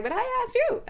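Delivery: high-pitched indignant comeback; mock retort. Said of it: "I didn't retort that".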